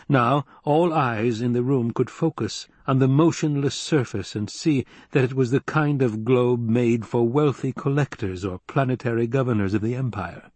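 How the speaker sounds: ordinary delivery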